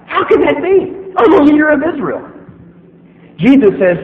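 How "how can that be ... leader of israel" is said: much higher, protesting Nicodemus; indignant Nicodemus. Retold or imitated. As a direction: play him offended at the suggestion. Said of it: "Nicodemus says".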